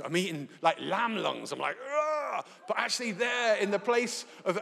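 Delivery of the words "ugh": high grossed-out ugh; disgusted ugh. Retold or imitated. Guest learning he ate lungs, high disgusted ugh. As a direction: recoil at the thought.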